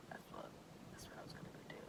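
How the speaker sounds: whisper. Real, not acted. Sudden hushed aside mid-story.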